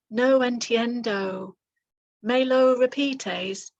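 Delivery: slow, halting learner Spanish; hesitant slow learner. Shown as a demonstration. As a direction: sound unsure of your Spanish, slowly. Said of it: "You're going to think".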